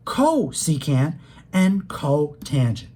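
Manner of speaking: long, slow CO in "cosecant" and "cotangent"; stress on CO. Shown as a demonstration. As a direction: draw out each "co" in "cosecant" and "cotangent". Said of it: "hear me emphasizing the word co".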